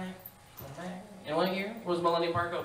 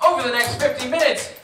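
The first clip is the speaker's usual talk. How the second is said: loud, bright host patter; game show host; 3 semitones higher, 7 dB louder than usual. Acted. Host welcoming viewers, big announcer read.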